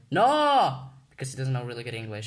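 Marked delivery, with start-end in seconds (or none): 0.1-0.9 higher, loud blunt retold refusal; loud, high mock refusal from dad; dad's blunt no. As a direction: bark a flat refusal like the dad. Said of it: "my dad was like".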